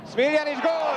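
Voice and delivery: high, loud goal shout. Real, not acted. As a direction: yell the goal. Goal call.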